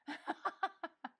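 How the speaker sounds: a quick, high burst of laughter; light embarrassed laugh; short laugh. Real, not acted. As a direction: laugh it off, amused at yourself. Laughs at her own remark.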